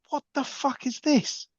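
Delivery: mock bewildered outburst; incredulous. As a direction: stretch it out like you can't believe your eyes. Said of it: "and I'm like".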